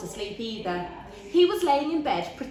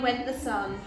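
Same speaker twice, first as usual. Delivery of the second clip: plain reading voice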